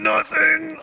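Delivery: high-pitched mock denial; strained denial. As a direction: insist it's nothing while straining. Acts out bro denying distress.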